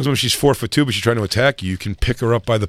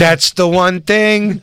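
loud, high, slowed right down; mock outrage; 7 semitones higher, 6 dB louder, much slower than usual. Comic declaring the one rule of the stage, loud mock outrage. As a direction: hammer it out with exaggerated indignation. Acting outrage at the mic grab.

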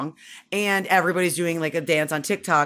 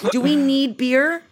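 put-on child's voice; 7 semitones higher, 4 dB louder, slower than usual. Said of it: "This is my five and a half year old".

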